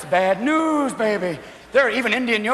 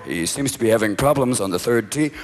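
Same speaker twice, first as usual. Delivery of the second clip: much lower golf announcer murmur; deep hushed golf commentary; hushed commentator; 11 semitones lower than usual. Retold or imitated.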